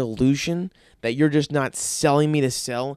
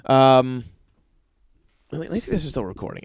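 usual speaking voice

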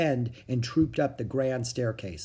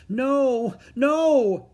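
put-on high, loud child's protest; frightened refusal; 11 semitones higher, 6 dB louder than usual. A narrator's reading. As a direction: cry out in refusal like a scared boy. Frightened boy refuses the lifeboat.

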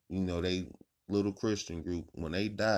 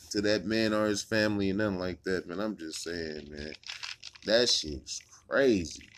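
usual speaking voice

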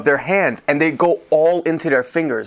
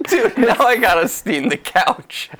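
laughing disbelief; 8 semitones higher than usual. Real, not acted. Laughing at the mess.

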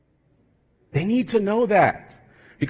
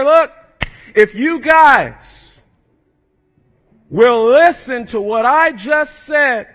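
loud, slow bellowing appeal; a preacher voicing Jesus bellowing to the crowd; 6 semitones higher, 9 dB louder, much slower than usual. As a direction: thunder it down the mountain. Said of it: "hear his voice bellowing down".

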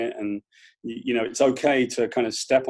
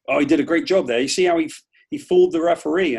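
a hint of an impressed sportscaster, a touch louder; admiring American commentator; 4 semitones higher, 6 dB louder than usual. Mocking Americans who admire a player fooling the referee, lightly voiced. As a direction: praise the cheat like it was clever. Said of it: "you mean, like".

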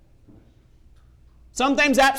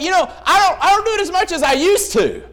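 excuse-making sinner; 7 semitones higher, 3 dB louder than usual. Retold or imitated. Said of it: "We're like".

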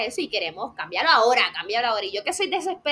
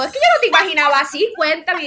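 loud, quick, eager chatter; eager, excited; 4 semitones higher, 7 dB louder than usual. Pretend. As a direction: rush it, thrilled to meet him. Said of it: "entonces empieza a cambiar el juego". (emocionada, rápida y fuerte)

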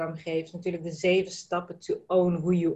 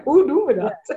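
laughing question; 4 semitones higher, 6 dB louder than usual. Real, not acted. Host laughs, delighted question.

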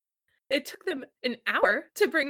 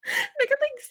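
laughing. Real, not acted. Laughing retelling.

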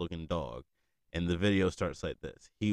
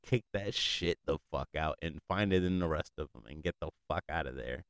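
usual speaking voice

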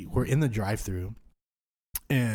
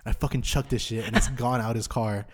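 usual speaking voice

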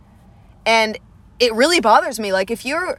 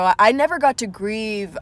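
ordinary talk

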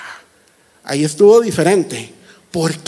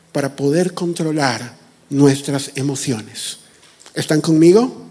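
ordinary talk, nothing marked

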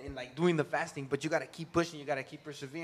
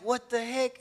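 higher, louder mock exasperation; high, loud "what the heck"; surprised; 8 semitones higher, 9 dB louder, slower than usual. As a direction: throw up your hands, baffled. Surprised at falling asleep.

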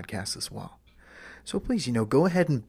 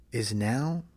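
usual speaking voice

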